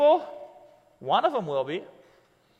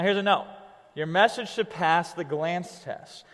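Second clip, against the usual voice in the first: ordinary talk, nothing marked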